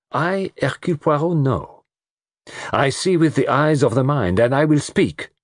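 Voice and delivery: high, theatrical detective's proclamation; grand Poirot. Acted. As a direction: announce yourself with self-important emphasis.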